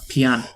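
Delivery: "pian" with the vowel broken in two; "pian", imitating a bad Irish vowel; mispronounced pain. Shown as a demonstration. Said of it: "the way he says pain".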